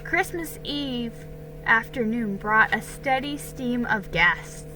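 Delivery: plain reading voice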